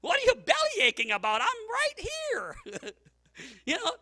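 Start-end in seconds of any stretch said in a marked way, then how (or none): 0.0-2.6 much higher, mock exasperated voice; wife scolding her husband from the doorway; wife's retort. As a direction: snap back like an annoyed wife. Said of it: "she comes in and says".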